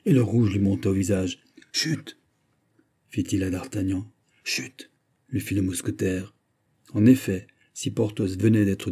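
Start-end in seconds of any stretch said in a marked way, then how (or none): 1.7-2.2 soft, high shushing; quick secretive shush; hushing. A narrator's reading. Secretly silencing his friend. 4.4-4.9 very soft shush in return; barely voiced shush; hushing. A narrator's reading. Friend hushes him back.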